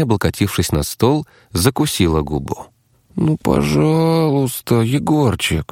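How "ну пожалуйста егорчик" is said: high, drawn-out wheedling; coaxing plea. A narrator's reading. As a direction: beg sweetly, stretching the words to get your way. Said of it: "Что за манипуляция".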